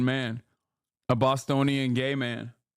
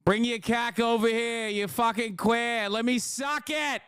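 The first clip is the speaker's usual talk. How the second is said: crude Boston bro; 8 semitones higher than usual. Pretend. Streamer clowning for chat, crude Boston bro voice.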